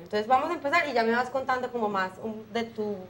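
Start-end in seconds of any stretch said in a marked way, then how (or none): none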